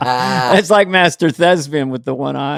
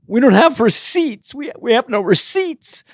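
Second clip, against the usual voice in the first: a higher-pitched hint of the woman; lightly voiced cashier; 8 semitones higher than usual. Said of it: "and the woman says".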